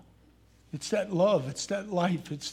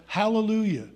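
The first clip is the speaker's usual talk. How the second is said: raised-voice hallelujah, not a full shout; mild mock yell; 3 semitones higher, 5 dB louder than usual. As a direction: call out a half-hearted yell. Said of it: "He should have just yelled".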